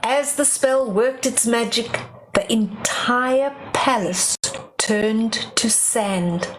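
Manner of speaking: usual speaking voice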